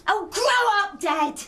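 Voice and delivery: sharp, exasperated retort; exasperated snap. Acted. Daughter snaps at father.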